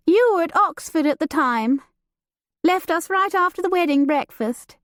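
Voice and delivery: light and teasing, a society lady; Judith, lively young lady. Acted. Judith teasing her cousin about the new servant, playful and high. Judith voiced beside narration.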